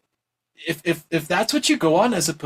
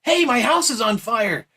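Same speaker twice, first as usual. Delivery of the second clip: higher, drawn-out mock yell; mock screaming; 8 semitones higher, 3 dB louder, slower than usual. Someone warning a neighbour of a house fire, mock screaming. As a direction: imitate someone raising the alarm. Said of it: "screaming says".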